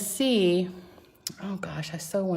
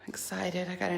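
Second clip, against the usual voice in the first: whispered; 7 dB quieter than usual. Acted. Whispered aside to viewers.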